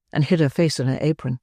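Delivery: ordinary delivery, nothing marked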